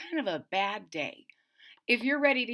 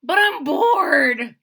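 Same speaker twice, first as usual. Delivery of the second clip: slow, loud, higher whine; whiny bored; 6 semitones higher, 10 dB louder, much slower than usual. Acted. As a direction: drag it out like a bored child. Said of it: "I said".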